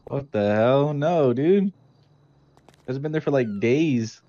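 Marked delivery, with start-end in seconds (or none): none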